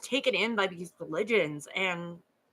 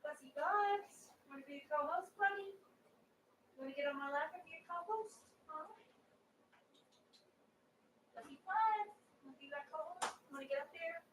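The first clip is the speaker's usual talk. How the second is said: high, soft cooing to a dog; 8 semitones higher, 12 dB quieter than usual. Real, not acted. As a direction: coo sweetly at the pup, way up high. Talking baby talk to her dog.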